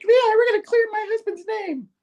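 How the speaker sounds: very high falsetto imitation of a woman; mock Betty voice. Betty backing Spidey up, in mock falsetto. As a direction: squeak it with mock determination. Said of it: "Betty's all like".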